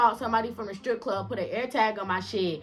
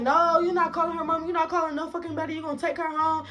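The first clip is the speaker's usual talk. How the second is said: imitated higher-pitched scolding; argumentative boyfriend; 6 semitones higher than usual. Boyfriend arguing over calling her mama, indignant. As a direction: forbid it, mock-indignant.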